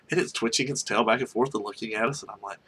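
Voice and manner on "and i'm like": usual speaking voice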